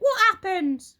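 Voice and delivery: put-on high, loud, upset old wife; Mrs Twit crying out. Old wife cries out to her husband, high and alarmed.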